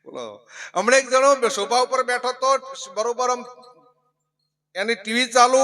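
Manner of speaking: usual speaking voice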